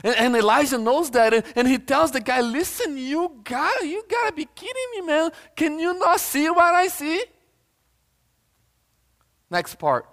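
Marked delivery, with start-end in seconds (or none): 2.4-7.2 very high, strained comic disbelief; incredulous prophet. Acted. Preacher voicing Elijah telling the guy off, high-pitched incredulity.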